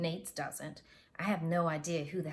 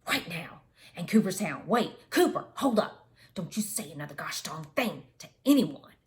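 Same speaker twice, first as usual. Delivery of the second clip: louder, furious fatherly orders; angry dad; 7 dB louder than usual. Retold or imitated. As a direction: shout the warning down the phone. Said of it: "he spits".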